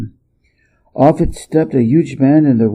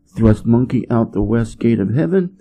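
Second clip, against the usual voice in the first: usual speaking voice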